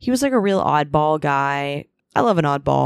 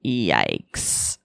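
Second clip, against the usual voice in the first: deadpan low yikes; 12 semitones lower, 4 dB quieter than usual. Retold or imitated. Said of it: "And I was just like".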